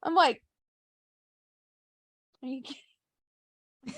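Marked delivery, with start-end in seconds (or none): 2.4-3.9 muttered disbelief. Retold or imitated.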